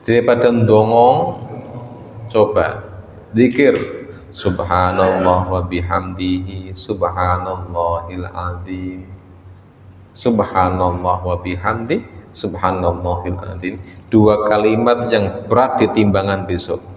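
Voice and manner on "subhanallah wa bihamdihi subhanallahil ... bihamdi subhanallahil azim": recited dhikr. Shown as a demonstration. Preacher recites dhikr formula.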